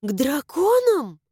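astonished gasp of a question; gasped surprise. A narrator's reading. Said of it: "ахнула я".

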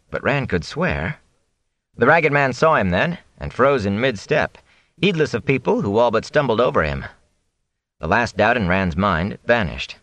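plain reading voice

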